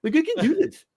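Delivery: high, laughing mock Elon voice; mock Elon Musk. Friend mocks Elon showing off a trick, high and giddy. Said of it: "Elon Musk is like".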